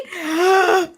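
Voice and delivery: sharp excited gasp; delighted gasp. Real, not acted. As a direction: gasp in happy surprise.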